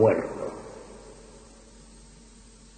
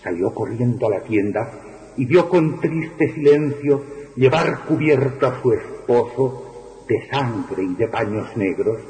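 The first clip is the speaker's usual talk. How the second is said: ordinary delivery, nothing marked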